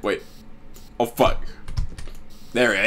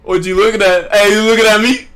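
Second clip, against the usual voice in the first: loud, high hollering at strangers; catcalling guy; 8 semitones higher, 4 dB louder than usual. Pretend. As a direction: holler across the street at a girl. Calling out to mannequins.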